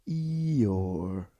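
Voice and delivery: long, drooping gloomy drawl; Eeyore. Acted. Recapper showing Luke's fallen face, glum donkey voice. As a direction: let the name sag slowly downward. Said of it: "to Eeyore".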